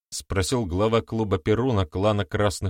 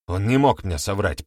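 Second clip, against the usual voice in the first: ordinary delivery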